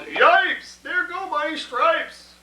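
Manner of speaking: high loud yipes, then slow; zebra yelling. Acted. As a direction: yelp out, dragging the stripes line. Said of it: "The zebra yelled".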